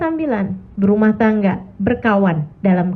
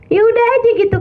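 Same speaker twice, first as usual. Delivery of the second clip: high emphatic; 12 semitones higher, 5 dB louder, much faster than usual. Real, not acted. Emphatic high loud advice.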